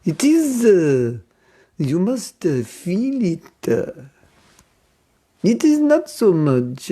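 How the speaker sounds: slow, hesitant French accent with filler "uh". Shown as a demonstration. French devotee explaining that feelings matter most, French accent. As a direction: put on a thick French accent, drawn out. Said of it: "I like the French accent".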